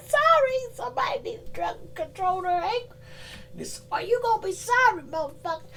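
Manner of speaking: high mock warning. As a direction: warn them in a squeaky voice, trailing away. Voicing a warning message.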